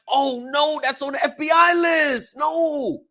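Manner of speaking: high, loud warning yell; alarmed shout. Retold or imitated. Reacting to the teachers he watched, a high alarmed shout. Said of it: "I'm like".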